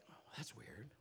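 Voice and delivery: voiceless whisper of unease; whispered to himself. Retold or imitated. Sitting alone after hearing the voice, whispering. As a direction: breathe it out, spooked and private. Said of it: "and was like".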